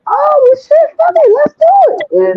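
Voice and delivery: jumping at the offer; excited go-for-it. Retold or imitated. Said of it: "I was like".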